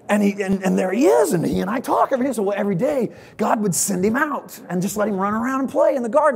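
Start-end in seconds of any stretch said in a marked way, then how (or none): none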